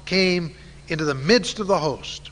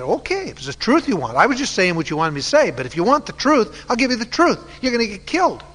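higher-pitched, mounting exasperation; exasperated retort; 6 semitones higher, faster than usual. Acted. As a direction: give in and hand over the blunt truth. Acted retort of exasperated prophet.

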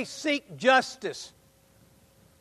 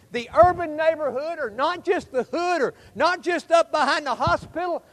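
ordinary talk, nothing marked

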